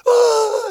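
a sudden high cry as she breaks down; a loud mock sob cry; imitated sob cry. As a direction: let out one sharp wail of tears. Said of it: "And then she really broke up".

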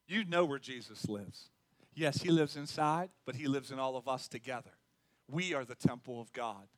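low, soft, intimate sermon voice; quiet, low-pitched pastoral assurance; hushed, earnest preaching. Real, not acted. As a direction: bring it right down and speak sincerely.

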